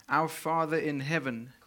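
ordinary talk

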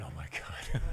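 mock horrified whisper. Wife in the back reacting to the accent, whispered. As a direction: breathe it out like a wife cringing in the pews. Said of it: "My wife is somewhere in the back going".

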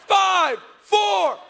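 high, loud count; countdown. Retold or imitated. Director counting down to air, high and loud. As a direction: call the last seconds out.

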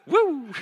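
loud, high-pitched "woo"; high whoop. Shown as a demonstration. Preacher whooping at the gym-goers in the audience. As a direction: let out one long falling whoop.

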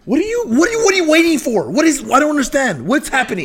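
high impatient demand. Real, not acted. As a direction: push them, exasperated.

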